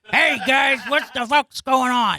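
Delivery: loud, slow slumped lady voice; Tina character voice. Acted. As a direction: drawl it out like a worn-out aunt. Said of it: "my Tina character".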